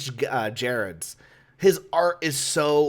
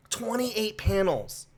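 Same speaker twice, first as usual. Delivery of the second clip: slower, moderately high stunned restatement; stunned disbelief; 5 semitones higher, 4 dB quieter, slower than usual. Real, not acted. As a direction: let it sink in slowly, pitch a little raised. Repeats the appalling count after pause.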